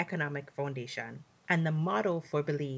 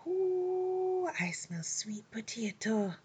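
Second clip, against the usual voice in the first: excited whisper; 13 semitones higher, slower than usual. A narrator's reading. Anansi sniffing food happily.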